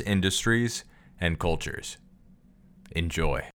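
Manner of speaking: bright, upbeat host read of a scripted intro; polished announcer voice welcoming listeners; podcast intro read. Acted. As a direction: sell the show like a radio announcer. Host reads the show intro.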